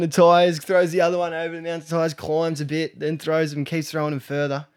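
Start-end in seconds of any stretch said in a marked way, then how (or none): none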